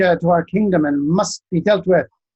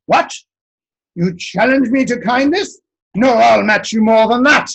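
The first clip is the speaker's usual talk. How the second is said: higher, louder boast; indignant giant; 4 semitones higher, 4 dB louder than usual. Acted. Giant counters the wise one's kindness, loud and indignant. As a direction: bristle and top the offer.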